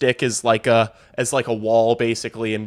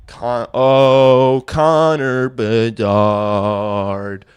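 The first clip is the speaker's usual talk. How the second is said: each Connor Bedard stretched out very slowly in awe; awestruck name calling; 5 dB louder, much slower than usual. Real, not acted. Streamer marvelling at Bedard's goal, drawn-out awed calling. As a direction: savour the name like you can't believe it.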